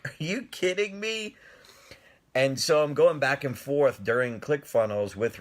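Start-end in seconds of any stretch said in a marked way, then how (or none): 0.0-1.4 disbelief. Retold or imitated. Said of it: "But I'm like".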